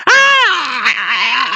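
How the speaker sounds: high crying shout of agony; mock dying yell. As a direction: Nazi melting, a high pained yell. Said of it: "going like".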